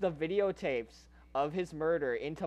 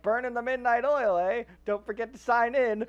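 higher-pitched, lightly acted desk greeting; chirpy receptionist; 5 semitones higher than usual. Receptionist to a late arrival, chirpy. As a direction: play a chatty front-desk worker, pitched up.

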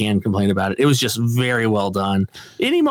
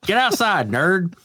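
mock taunt; 7 semitones higher, slower than usual. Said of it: "quoting show line".

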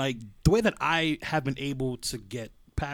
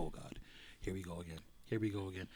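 much softer, weary sigh of dread; weary groan; 6 semitones lower, 13 dB quieter, faster than usual. Retold or imitated. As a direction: mutter it like you're already tired of it. Dreading dwelling again.

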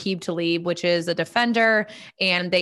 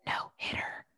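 whispered word; 15 dB quieter than usual. Shown as a demonstration. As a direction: hush the word down. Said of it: "I'm gonna whisper".

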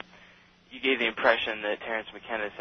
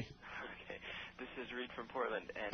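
faint caller far from the phone; quiet, distant phone voice; over the phone; 16 dB quieter than usual. Real, not acted. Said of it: "speak into your phone like you mean it".

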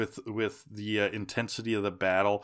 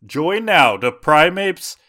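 infomercial; 7 semitones higher, 4 dB louder than usual. Acted. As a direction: sell it like a late-night pitchman. Parody ad for Primeape workout tapes.

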